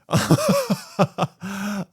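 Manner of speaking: high laugh at own bit. Real, not acted. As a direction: burst into laughter after the joke. Laughs at his own mock scream.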